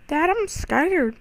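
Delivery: put-on high, slow frightened child voice; scared kid voice. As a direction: play a little kid calling for dad. Pretend scared kid calling father.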